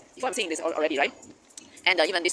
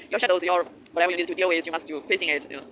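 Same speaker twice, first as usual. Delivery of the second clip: ordinary talk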